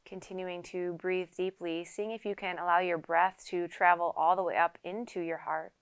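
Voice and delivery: unhurried yoga teacher leading a quiet meditation; guided meditation. Acted. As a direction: calmly guide them into stillness, leaving space to breathe. Leads a seated guided meditation.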